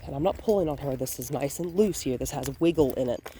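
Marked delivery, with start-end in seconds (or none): none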